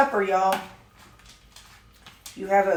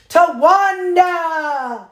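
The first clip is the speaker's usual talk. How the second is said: long drawn-out high yell; battle yell; 9 semitones higher, 5 dB louder, much slower than usual. Retold or imitated. Woman ramming a rival's car yells a drawn-out war cry. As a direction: shout the name like a war cry, slowly stretched. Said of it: "she yelled out".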